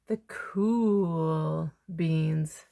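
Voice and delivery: slow, low, laid-back cool voice; stretched-out cool-guy delivery; cool drawl. Acted. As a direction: drawl it like the coolest kid around. Cool beans intro, drawn out.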